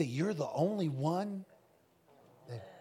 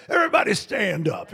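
gravelly preacher voice; 7 dB louder than usual. Retold or imitated. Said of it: "but with gravel in his voice".

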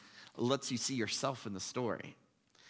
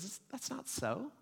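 higher, much softer calm denial; fire angel; 9 semitones higher, 6 dB quieter than usual. Retold or imitated. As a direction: gently correct him. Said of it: "the fire angel says".

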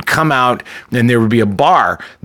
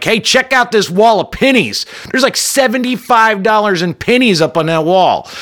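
overly cheerful; 10 semitones higher than usual. Retold or imitated. Narrator trying to distract his date.

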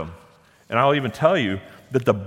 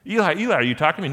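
higher, louder, eager questioning call; retold calling out; 6 semitones higher, 5 dB louder, much faster than usual. Preacher voices young Samuel waking Eli, calling out eagerly. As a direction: call out as if summoned in the night.

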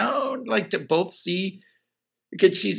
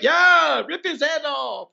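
hammed-up bloodthirsty cheer; excited cheer; 9 semitones higher, slower than usual. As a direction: cheer like a thrilled spectator. Nandor cheering at fight.

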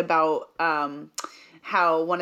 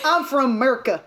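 hammed-up American accent, laughing; joking, exaggerated homegrown American accent; mock American accent; 4 semitones higher, slower than usual. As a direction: play up a slurred American "Merca". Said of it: "ours is just, like".

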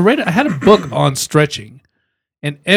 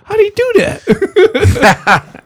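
high-pitched, drawn-out laughing question; 11 semitones higher, much slower than usual. Real, not acted. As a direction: ask it slowly through helpless laughter. Laughing in reaction to a mishap.

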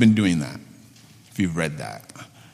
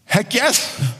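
an eager, higher "heck yes"; emphatic heck yes; 10 semitones higher than usual. Retold or imitated. Pastor recalls the church's eager reply to families, enthusiastic. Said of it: "you know what we said?".

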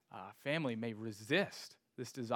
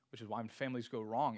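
ordinary talk

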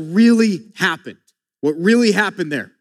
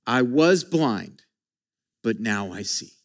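softer, slower, reverent; tender testimony; 9 semitones lower, 5 dB quieter than usual. Retold or imitated. Healed man quietly testifying to the Pharisees, tender. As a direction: let it fall gently, full of wonder.

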